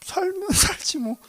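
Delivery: high, slow, laughing don't-care reply; breezy laughing indifference; mock careless shrug. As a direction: toss it off like it doesn't matter. Careless reply to God question.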